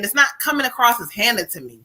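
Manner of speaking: ordinary talk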